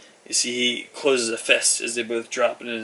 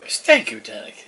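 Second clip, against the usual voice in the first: much higher, beaming; delighted thanks; 11 semitones higher, 4 dB louder than usual. Acted. Dee thanks Tannic, high and delighted.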